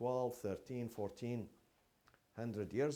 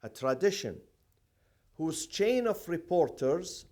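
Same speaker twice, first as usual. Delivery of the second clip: loud, slow classroom dictation; 4 semitones higher, 8 dB louder than usual. Shown as a demonstration. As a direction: dictate emphatically, pausing between phrases. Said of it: "say it very slowly".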